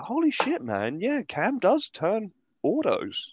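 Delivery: host recalls realising the guest grows autos, high mock amazement; mock surprise. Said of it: "and I was like".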